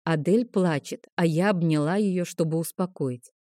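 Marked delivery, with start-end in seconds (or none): none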